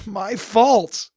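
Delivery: loud, high and broken; anguished cry. Acted. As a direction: cry out the blame on yourself. Guilt-ridden outburst continues.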